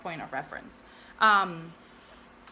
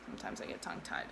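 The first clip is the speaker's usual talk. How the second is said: whispered aside; 11 dB quieter, much faster than usual. Real, not acted. Embarrassed aside after a slip.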